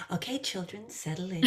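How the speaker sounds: softly gather the pretend children; mock storytime reader. Reader to pretend children before reading aloud, mock storytime hush. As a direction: play at settling a class for storytime.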